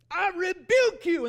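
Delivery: sudden booming yell, high and slow; sudden shout. Retold or imitated. Preacher in the car rebukes a thought with a shout. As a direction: bellow it out like casting out a demon.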